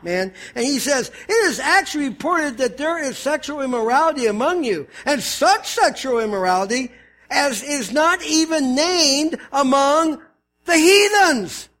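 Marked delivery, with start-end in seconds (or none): 1.3-11.7 ticked off, slowing hard onto the last words; Paul's fervency. Acted. Pastor voices Paul scolding the church, hot and fervent. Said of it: "can you hear the fervency in Paul's voice".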